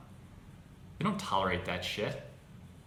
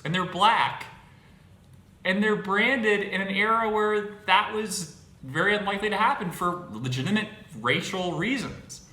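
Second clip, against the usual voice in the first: louder and higher, pressing the point; heated rant; 8 semitones higher, 7 dB louder than usual. Real, not acted. Defending the brands, ranting louder in frustration. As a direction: push it out hard, exasperated.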